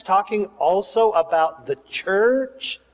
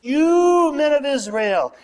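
raised, high preacher's call; yelling to a crowd; 8 semitones higher than usual. Retold or imitated. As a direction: imitate Peter hailing a crowd from a balcony. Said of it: "he yells to the crowd".

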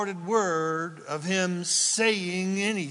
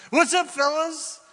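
high, loud breezy greeting; Jesus breezily greeting the disciples; casual Jesus; 11 semitones higher, 6 dB louder than usual. Retold or imitated. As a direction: pop in like an old friend.

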